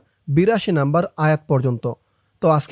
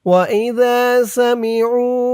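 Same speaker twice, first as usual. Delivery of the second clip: slow melodic tajweed chant; Quran recitation; 5 semitones higher, much slower than usual. Shown as a demonstration. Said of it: "teacher recites verse again". (কুরআন তিলাওয়াতের সুরে)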